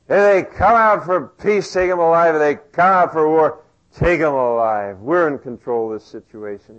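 loud swaggering macho voice; macho boast. Acted. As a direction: puff up and boast. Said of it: "stupid macho thing".

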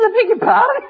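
high, rising mock disbelief; incredulous. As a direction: act out being taken aback. Startled reply in retold exchange.

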